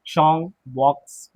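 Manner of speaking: ordinary talk, nothing marked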